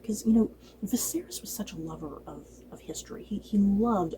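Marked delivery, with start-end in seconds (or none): 0.8-3.2 hushed aside; softly whispered remark. Real, not acted. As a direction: drop to a whisper about the late king. Sudden whisper mid-recap.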